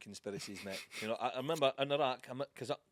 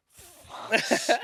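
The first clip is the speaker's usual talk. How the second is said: high, loud laughing curse; half-laughed exclamation of dismay; mock curse; 9 semitones higher, 9 dB louder than usual. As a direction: blurt it out through a laugh. And I'm like.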